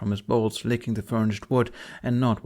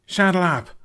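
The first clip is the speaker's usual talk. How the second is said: higher, louder urgent command; order; 8 semitones higher, 7 dB louder than usual. Acted. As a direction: snap the order to mount up. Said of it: "Hiccup ordered".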